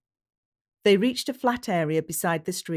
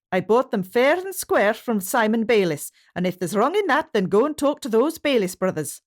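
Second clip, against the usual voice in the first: higher, louder Scots protest; a Scottish shepherd telling off his accusers; indignant Scottish shepherd; 5 semitones higher, 5 dB louder than usual. A narrator's reading. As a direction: defend yourself in a Scottish brogue. James voiced by narrator.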